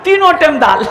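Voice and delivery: ordinary talk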